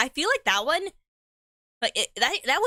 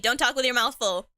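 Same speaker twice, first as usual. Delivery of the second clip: ordinary delivery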